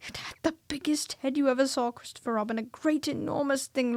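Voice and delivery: a scared little animal groping for words; flustered Piglet. A narrator's reading. Piglet describes the monster.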